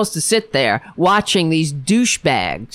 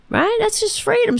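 sarcastic high sneer. Acted. Bitter sarcastic punchline.

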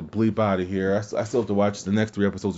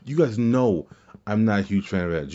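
ordinary talk, nothing marked

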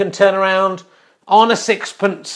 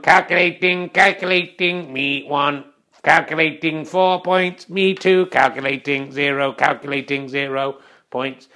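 monotone robot scorekeeper, halting word by word; mechanical, measured computer voice; 4 semitones lower than usual. Acted. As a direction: read the score like a calculating machine. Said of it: "let's go to the robot voice".

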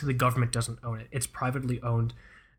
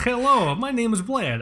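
put-on high-pitched comic Russian accent; Vlad; 9 semitones higher, 4 dB louder than usual. Podcast host introducing his Vlad character, mock Russian accent. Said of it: "Otherwise, I lose accent".

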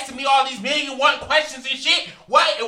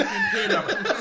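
much higher, fast, laughing as she says it; retold demand breaking into laughter; laughing mock demand; faster than usual.

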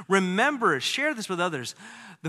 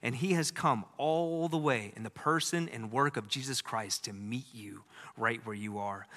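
ordinary delivery

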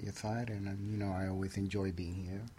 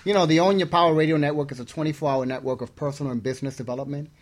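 radio announcer; 3 semitones higher, 10 dB louder than usual. Real, not acted. Cohost hypes network like announcer.

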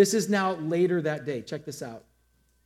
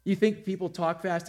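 usual speaking voice

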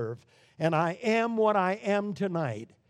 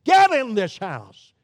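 loud sharp fatherly command, mock sweet; ironic sweetly; 4 semitones higher, 9 dB louder than usual. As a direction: bark the order, sweetness gone. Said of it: "very sweetly you say".

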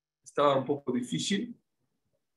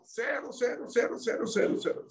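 fading to a whisper; 8 semitones higher, 6 dB quieter than usual. Shown as a demonstration. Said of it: "repeats fading".

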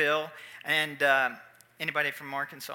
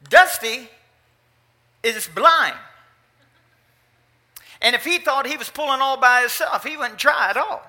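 punchline reveal; 7 semitones higher, 9 dB louder than usual. Retold or imitated.

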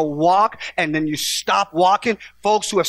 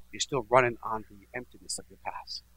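softer, breathy hush; preacher's whisper; 6 semitones lower, 9 dB quieter than usual. Real, not acted. Preacher whispering to the congregation. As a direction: lean in and whisper it. Sermon point dropped to whisper.

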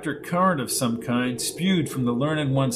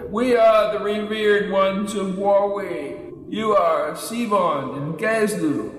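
ancient elder; 6 semitones higher, slower than usual. A narrator's reading. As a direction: intone like an ancient sage. Ancient dwarf elders speak.

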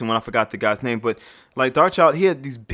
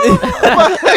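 laughing; 9 dB louder than usual. Real, not acted. Ironic laughing yeah.

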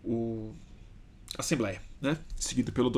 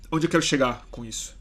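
ordinary delivery

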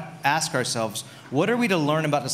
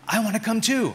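higher, mock eager-child voice; imitating young daughter; 7 semitones higher than usual. Daughter asking to tag along, imitated child voice. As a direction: pipe up like a little kid, lightly imitated. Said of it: "and Junie says".

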